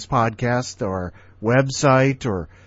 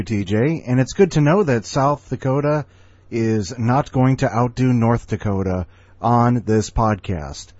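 usual speaking voice

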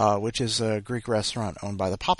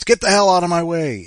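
loud, high angry shout; mock yell; 8 semitones higher, 11 dB louder than usual. Cyclist yelling at pedestrians on the sidewalk, angry shout. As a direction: bark it at people blocking the sidewalk.

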